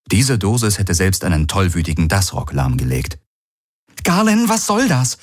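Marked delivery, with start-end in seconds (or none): 4.0-5.2 very high-pitched alarmed outcry; alarmed protest. Acted. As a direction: challenge a comrade in alarm, pitch soaring. Kirai protestiert entsetzt gegen die Injektion. (entsetzt und schrill hoch gerufen)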